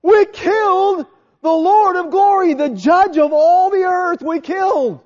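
high, slow, loud anguish; horrified crowd. Retold or imitated. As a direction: voice the crowd's dawning horror. Crowd voiced in dismay.

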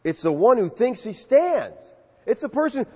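ordinary talk, nothing marked

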